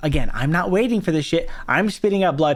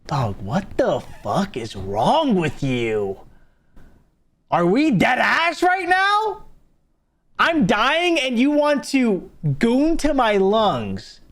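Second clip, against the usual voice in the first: disgusted disbelief; 3 semitones higher, slower than usual. Real, not acted. Reacting to a gross chat comment.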